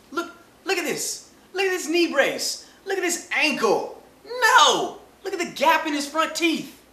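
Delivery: high, loud disbelieving shouts; high incredulous yelling. Acted. Ribbing Robert Griffin about his knee brace, high loud yelling. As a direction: point and yell in disbelief.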